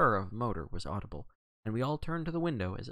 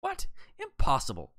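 startled outburst, punched louder; shocked exclamation; 4 semitones higher, 5 dB louder than usual. A narrator's reading. Two men react to Poirot's claim, shocked. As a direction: burst out in disbelief. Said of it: "broke simultaneously from both men".

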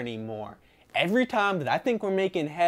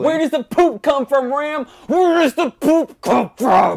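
shouting; 8 semitones higher, 10 dB louder than usual. Acted. Roommate demands answer angrily.

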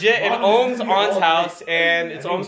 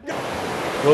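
usual speaking voice

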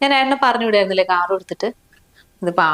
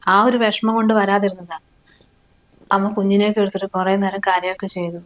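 ordinary talk

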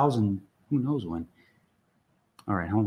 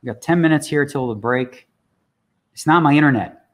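ordinary delivery

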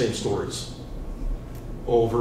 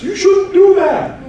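much higher, louder, slow finger-wagging; mocking the outraged public, much higher, louder and slow; mock scolding; 6 dB louder, slower than usual. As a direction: scold like a prim busybody.

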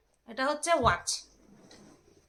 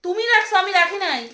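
snapping back; 7 semitones higher, 9 dB louder than usual. Real, not acted. Arguing with husband.

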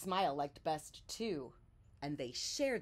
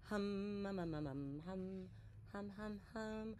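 humming; 10 dB quieter than usual. A narrator's reading. Bear hums softly along with Bird's song. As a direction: hum gently along with the bird. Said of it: "Bear began to hum".

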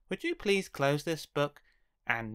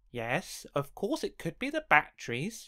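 plain reading voice

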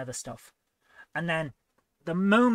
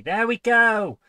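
loud, high burst of relief; real relieved delight; 7 semitones higher, 11 dB louder, slower than usual. Real, not acted. Streamer finding the missing sprites, loudly delighted. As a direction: call it out triumphantly, higher and much louder.

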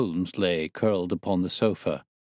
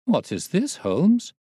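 high, louder exclamation of amazement; astonished cry; 10 semitones higher, 4 dB louder, slower than usual. A narrator's reading. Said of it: "I cried".

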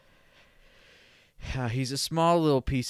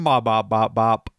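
playful bops; 8 dB louder than usual. Acted. Playful sound riff.

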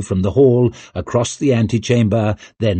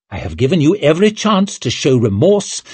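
raised, indignant royal rebuke; stern condemnation; 6 semitones higher, 3 dB louder than usual. A narrator's reading. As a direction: press the accusation home. King condemns the guilty lord.

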